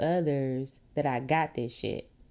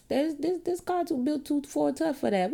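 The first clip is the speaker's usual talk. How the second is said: mock car owner; 9 semitones higher than usual. Voicing a stubborn car owner.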